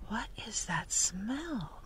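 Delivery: slow, hushed whisper; wondering cat's whispered thought; thinking to himself. A narrator's reading. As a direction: whisper it slowly, like a private thought. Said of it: "Marco thought".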